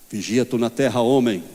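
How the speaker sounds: mock-solemn admonishing voice; imitating the leader's intonation. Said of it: "tentar fazer a, a, a entonação dele".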